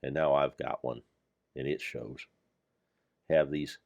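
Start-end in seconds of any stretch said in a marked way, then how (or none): none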